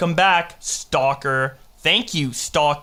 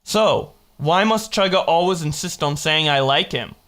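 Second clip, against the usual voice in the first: usual speaking voice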